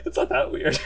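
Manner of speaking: high giggly laugh through words; laughing while protesting. Real, not acted. Laughing after the teasing bit.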